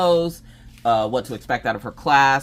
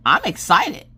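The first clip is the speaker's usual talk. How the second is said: imitating daughter; 7 semitones higher, 6 dB louder than usual. Daughter Maggie answers the teacher.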